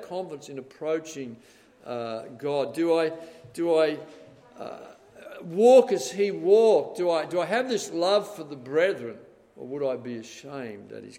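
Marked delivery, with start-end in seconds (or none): 2.7-8.3 fervent preaching. Real, not acted. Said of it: "preacher builds to climax".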